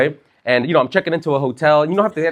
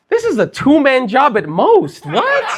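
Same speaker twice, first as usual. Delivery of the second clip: incredulous scoff; 8 semitones higher, 3 dB louder, slower than usual. Retold or imitated. Thinking at thieves outside.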